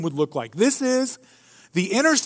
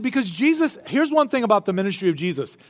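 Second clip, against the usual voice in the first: ordinary talk, nothing marked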